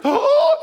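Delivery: loud, high mock gasp; excited gasp. Senior women pointing out firefighters, imitated excited gasp. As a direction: gasp like a smitten admirer, hammed up. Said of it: "and going crazy".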